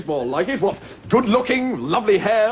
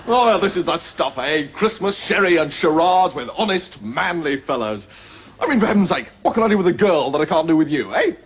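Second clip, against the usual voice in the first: usual speaking voice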